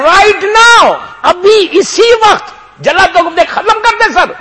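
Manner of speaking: high, loud shouted command to the executioner; furious order. Retold or imitated. Ibn Ziyad ordering the execution, high furious shout. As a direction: bark the order, enraged governor. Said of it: "کہتا ہے".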